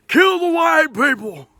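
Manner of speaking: hammed-up chant of a screaming crowd; mock scream. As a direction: imitate the mob yelling.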